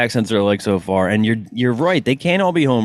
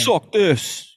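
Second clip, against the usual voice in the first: raised, mock crude "Suck this"; quoting Husey; 7 semitones higher than usual. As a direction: toss it off like the rude guest. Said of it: "when he gets up to grab his junk".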